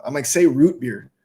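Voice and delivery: normal talking voice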